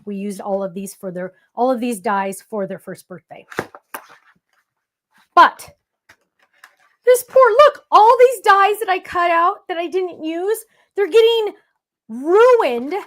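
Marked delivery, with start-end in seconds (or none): 5.3-12.9 dismay. Real, not acted. Showing ruined dies, distressed.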